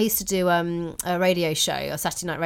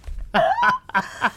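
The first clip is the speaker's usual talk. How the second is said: high laugh. Real, not acted. Laughing at friend's look.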